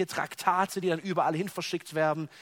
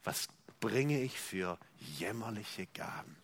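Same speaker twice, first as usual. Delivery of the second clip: "Leer, leer" low and soft, falling into near silence; quiet lament; 9 semitones lower, 10 dB quieter, slower than usual. Retold or imitated. Preacher admitting his own emptiness to God, quiet lament. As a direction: confess it hushed and low. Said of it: "dass ich sagen muss".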